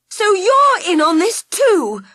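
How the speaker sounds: high, loud and slow in fury; betrayed girl crying out in anger; furious cry. Acted. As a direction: fling the accusation out, outraged. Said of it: "Ruby cried, furiously".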